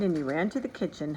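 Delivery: usual speaking voice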